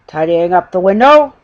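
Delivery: loud, sputtering disbelief; spluttered. A narrator's reading. Detective catching a bear in the shop window, spluttering. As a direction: splutter it out, outraged. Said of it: "the detective spluttered".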